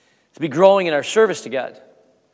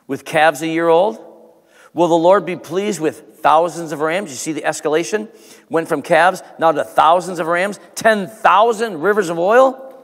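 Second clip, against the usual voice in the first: loud, rising preacher's delivery, well above his usual level; full-force preaching; 5 semitones higher, 7 dB louder than usual. Acted. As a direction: proclaim the scripture to the congregation. Preaching Micah passage at full force.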